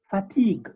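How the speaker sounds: fatigue ending on the g, "fa-TEEG"; mute final E. Shown as a demonstration. Teacher repeats word without accent, fatigue with silent ending. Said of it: "the E is totally mute".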